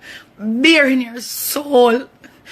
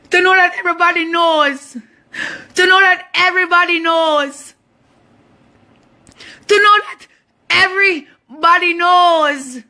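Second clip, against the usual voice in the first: raised pitch, real distress; anguished; 5 semitones higher than usual. Real, not acted. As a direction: press each repeat out higher, pained. Anguished repeats about public exposure.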